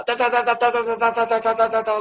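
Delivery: high rapid-fire monotone da-da-da; single-syllable tongues. Shown as a demonstration. Preacher shows the congregation single-syllable tongues, high rapid chatter. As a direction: rattle one syllable out on a high pitch. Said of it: "单音节打".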